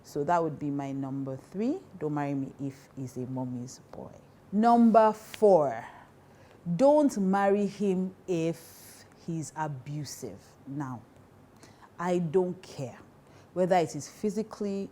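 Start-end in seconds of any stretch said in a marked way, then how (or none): 4.5-10.4 slow, weighty, every word separated; slow emphatic point. Real, not acted. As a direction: lay down the rule firmly and slowly.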